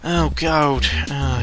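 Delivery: ordinary delivery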